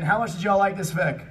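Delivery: ordinary talk, nothing marked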